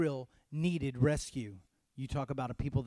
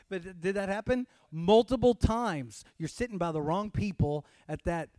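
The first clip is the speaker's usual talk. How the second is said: ordinary delivery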